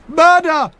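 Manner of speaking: high, loud desperate cry; cry of murder. Acted. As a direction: yell it across the marsh. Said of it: "one cried".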